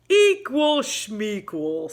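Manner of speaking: slow, drawn-out scoffing bear voice; dismissive bear. Acted. Bear scoffing at Mouse about fair teams, slow and drawn out. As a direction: brush it off like a careless bear. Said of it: "said Bear".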